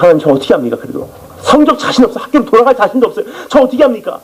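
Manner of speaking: high, pleading cry to God; pleading prayer. Retold or imitated. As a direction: beg God for help, voice lifted high. Retold desperate prayer. (하나님께 높은 목소리로 애원하듯)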